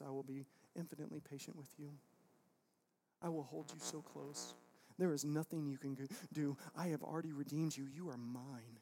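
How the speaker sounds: soft, low voice of a patient God; tender reassurance. Acted. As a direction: promise love gently and quietly, as God. Voices God's patient, tender promise.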